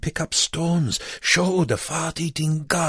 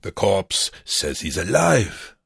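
slow, mocking announcement; loud announcement; 3 semitones higher, slower than usual. A narrator's reading. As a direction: say it out for the guard to overhear. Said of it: "again loud enough for the S S guard to hear".

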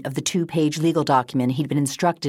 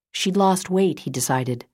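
plain reading voice

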